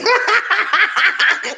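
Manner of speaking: high-pitched cackling laugh; high rapid cackle. Real, not acted. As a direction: burst into a long, high laugh. Host laughs after own joke.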